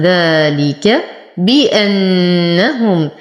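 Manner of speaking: crisp hamza, long nasal n, full hu in بأنهم; full ghunnah. Shown as a demonstration. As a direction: chant ذلك بأنهم slowly, humming the doubled n. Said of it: "نشد النون بغنة أكمل". (ترتيل ذلك بأنهم مع غنة النون)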